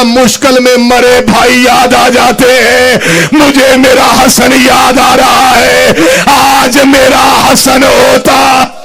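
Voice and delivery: slow, loud grieving shout; wailing cry. A narrator's reading. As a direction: cry it out slowly. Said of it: "Hussain चीख मार के कहता". (धीमी, ऊंची रोती हुई चीख)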